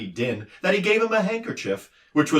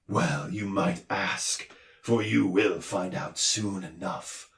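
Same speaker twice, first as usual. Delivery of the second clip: softer, slow and sad; heavy-hearted warning; doctor warns them gloomily; sad farewell; 3 semitones lower, 6 dB quieter, slower than usual. A narrator's reading. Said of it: "he sadly bid them farewell".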